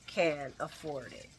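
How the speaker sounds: soft, slow, pointed Tracy voice; a hushed, knowing warning; imitating Tracy. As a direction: lean in quietly and point the finger. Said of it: "Tracy's like".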